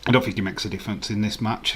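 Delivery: ordinary delivery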